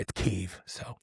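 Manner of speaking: Kyiv said as KEE-iv, not Kiev; Kyiv pronunciation. Shown as a demonstration. Host correcting the name for listeners, KEE-iv. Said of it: "it's not Kiev".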